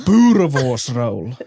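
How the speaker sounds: slow, deliberate "Boerewors roll" with South African sounds; drawn-out South African "Boerewors roll"; South African pronunciation demo. Shown as a demonstration. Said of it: "very lightly".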